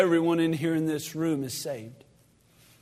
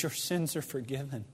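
gentle forgiveness; 5 semitones lower, 12 dB quieter than usual. Acted. Jesus speaks forgiveness gently.

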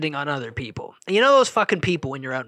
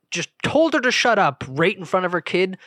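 ordinary delivery

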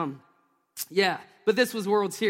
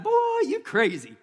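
ticket lady's disbelief; 8 semitones higher than usual. Retold or imitated. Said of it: "she like".

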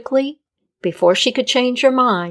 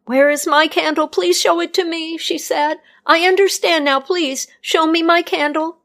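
put-on higher, urgent pleading young woman; pleading healer; 8 semitones higher than usual. A narrator's reading. Healer begging Death to show her candle, pleading. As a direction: beg with rising panic.

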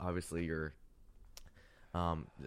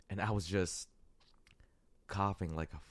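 usual speaking voice

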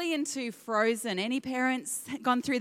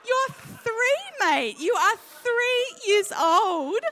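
exasperated mum; 11 semitones higher, 8 dB louder, much slower than usual. Retold or imitated. Mum exasperated at her son.

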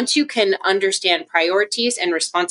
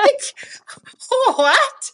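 stunned; 3 dB louder than usual. Retold or imitated. Stunned by coworker's reply.

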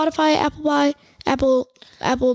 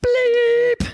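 sharp high mock censor bleep; high bleep sound; 12 semitones higher than usual. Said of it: "Listen to this".

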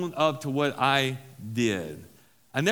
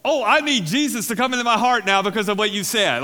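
higher, louder mock-gushing convert; 5 semitones higher, 6 dB louder than usual. Pastor imitates an imagined convert he never had, hammed up. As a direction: ham up a grateful convert. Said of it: "No one ever like".

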